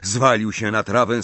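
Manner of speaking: plain reading voice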